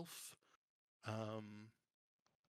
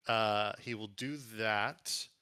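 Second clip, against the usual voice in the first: ordinary delivery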